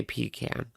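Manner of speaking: "pecan" said PEE-can, long ee first; PEE-can pronunciation. Shown as a demonstration. Telling viewers what many say, "pecan" as PEE-can. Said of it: "A lot of people say pecan".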